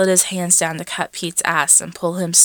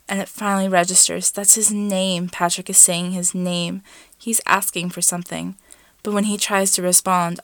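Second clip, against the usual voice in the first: plain reading voice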